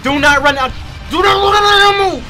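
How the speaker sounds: high, loud, desperate begging not to run out; terrified pleading. Real, not acted. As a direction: panic rising as they close in. Terrified while chased.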